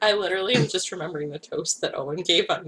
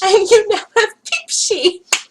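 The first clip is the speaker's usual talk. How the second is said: laughing; 13 semitones higher, 9 dB louder, slower than usual. Real, not acted. Laughing reveal of peep sheet.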